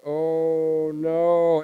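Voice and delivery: long, loud re-enacted groan of dread; retold dismay. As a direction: stretch it out in acted horror. Retelling his dismay at the blood.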